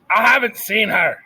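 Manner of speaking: loud, high shout. A narrator's reading. Scene: Louise shouting at Cook in the kitchen, loud. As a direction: Louise yells she hasn't seen her. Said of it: "Louise was shouting".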